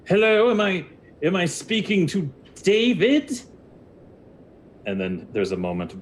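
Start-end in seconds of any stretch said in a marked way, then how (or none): 0.1-3.4 hesitant lizard wizard. Acted. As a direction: call uncertainly into a magic rock. Wizard calls into magic rock.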